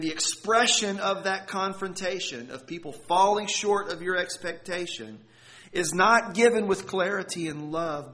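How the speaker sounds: loud, driving pulpit delivery; full-voiced sermon climax; forceful preaching. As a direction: press the point home to the congregation.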